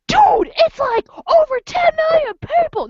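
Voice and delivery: hammed-up excited fan; mock know-it-all dude; guy bragging. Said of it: "this guy goes".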